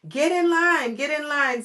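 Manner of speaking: high, loud call to line up; teacher calling. Acted. The teacher hurries her class into line, loud and high. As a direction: herd the kids into line. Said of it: "said Missus".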